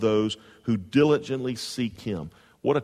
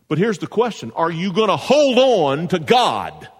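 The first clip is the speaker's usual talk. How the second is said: higher and louder, driving each question home; full-force preaching; 5 semitones higher, 3 dB louder than usual. Real, not acted. Pastor pressing the congregation about tough times, full-force preaching.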